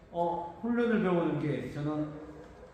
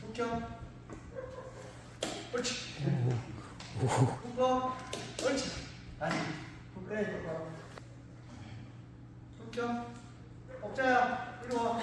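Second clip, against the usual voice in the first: talking to a pig; 6 semitones higher, 4 dB quieter than usual. Real, not acted. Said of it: "이제 정밀도를 크게 해볼게요".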